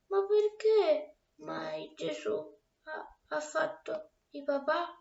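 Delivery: mild sobbing. Acted. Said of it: "singhiozzando al buio nel suo lettino".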